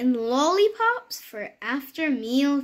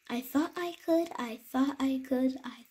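softer each time, ending in a whisper; fading away; 6 dB quieter than usual. Acted. Happy little engine puffing downhill, fading to a whisper. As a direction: let it drift off into the distance.

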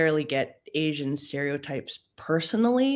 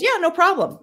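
friend agreeing; 13 semitones higher, 5 dB louder than usual. Retold or imitated. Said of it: "And he goes".

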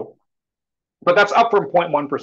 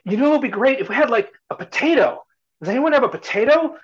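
much higher coaxing question; coaxing stranger; 10 semitones higher, 4 dB louder than usual. Retold or imitated. Stranger coaxing villagers for a potato. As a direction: tempt them to add more.

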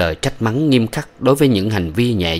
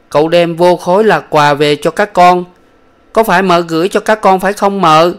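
put-on high, louder childlike chatter; little boy voice; 10 semitones higher, 6 dB louder than usual. Son telling his mother how father missed her, high child voice. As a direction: tell it all in a rush like an eager small boy.

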